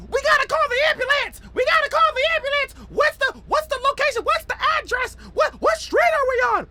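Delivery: imitating panicked girls shouting for an ambulance. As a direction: mimic two girls freaking out, high and frantic. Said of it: "In a panic".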